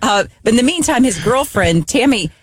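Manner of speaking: ordinary talk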